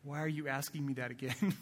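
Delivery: soft, weary, fed-up murmur; exasperated parent. Pretend. Parent to a child asking the same thing again, weary and soft. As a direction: sound like you've answered this a hundred times. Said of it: "you know that sigh".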